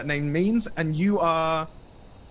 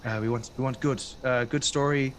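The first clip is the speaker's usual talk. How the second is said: usual speaking voice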